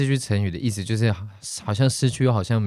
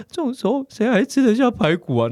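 much higher, half-laughing despair; who could eat at a time like this; exasperated tearful thought; 11 semitones higher than usual. Retold or imitated. At the pork chop shop, tearful exasperated outburst.

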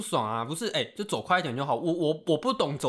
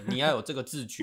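usual speaking voice

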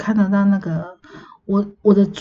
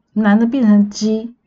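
ordinary talk

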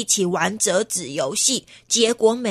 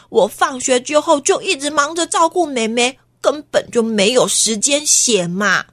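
ordinary delivery, nothing marked

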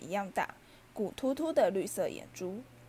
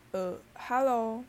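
slightly nervous, drawn-out hesitant hello; a touch of nerves, slowly feeling out the greeting; slower than usual. A narrator's reading. Harry greeting the strange creature in his room, nervously. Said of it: "哈利紧张地说".

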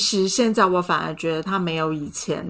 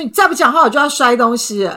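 threat; 4 semitones higher, 6 dB louder than usual. Retold or imitated. Threatening boyfriend in retold fight.

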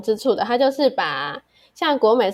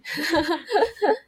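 short laugh; 7 semitones higher than usual. Real, not acted. Joke about Korean oppa.

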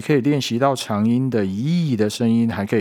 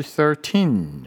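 put-on thirteen with teen higher, slow; stress on teen; 6 semitones higher than usual. Shown as a demonstration. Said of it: "后面那个音节是比较高音的". (thirteen后面的teen念高音)

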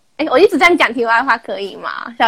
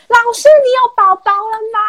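put-on higher child's voice, loud and curious; 10 semitones higher, 4 dB louder than usual. Kid shouting at teacher in the park, childlike. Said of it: "然后他就很大声".

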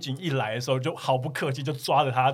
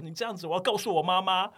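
mock tantrum cry, higher pitched; retold yelling; 6 semitones higher than usual. Drunk customer threatens the bar staff, imitated higher-pitched yelling. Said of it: "就是一直在叫说".

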